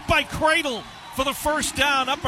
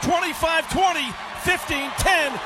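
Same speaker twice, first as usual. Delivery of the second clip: touchdown call; 9 semitones higher than usual. Real, not acted. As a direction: shout it home as he scores.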